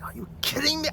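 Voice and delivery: high, hushed mock outrage; squeaked disbelief, reenacted without full volume; retold outrage. Driver to mechanic over a stolen converter, mock outraged. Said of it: "I, I lost it for a minute".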